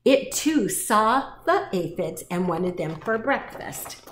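usual speaking voice

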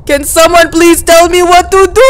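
wailing plea. Pretend. Stuck player begging viewers, high mock wail. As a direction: plead loudly up high, stretching the end.

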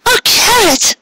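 very high, small delighted cry; little voice. Acted. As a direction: squeak his name like a thrilled little mare. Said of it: "she said in a little voice".

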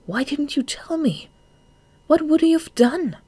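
very high, rising to loud protest; upset demand. A narrator's reading. Shade demands why Marina kept it secret, upset. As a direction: push the questions out high and distressed.

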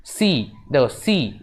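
সি said slowly, its "ee" ending held; drawn-out সি. Shown as a demonstration. Teacher showing pupils the vowel in the letter, সি drawn out. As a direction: stretch both সি so the "ee" rings out. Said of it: "ই উচ্চারণ হচ্ছে".

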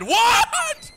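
high, loud shocked What?; loud startled What?; startled shout. Real, not acted. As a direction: blurt it out in shock. Startled by a game jump scare.

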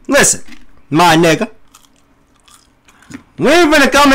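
loud, heated tough-guy rant at chat; hammed-up furious streamer yelling; mock angry streamer rant. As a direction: chew out disrespectful chat at full volume.

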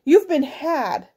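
angry, slow, rising scold; furious mother. A narrator's reading. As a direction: tell him off slowly. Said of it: "She was furious".

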